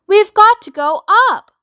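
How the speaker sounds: high, loud insistence; yelling back. A narrator's reading. Mister Wonka insisting on climbing, yelled back. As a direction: shout it over the roaring wind. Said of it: "Mister Wonka yelled back".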